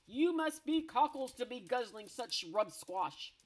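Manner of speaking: loud, sneering brute giant; scornful giant. Acted. Blood Bottler scorning the Snozcumber, loud sneer.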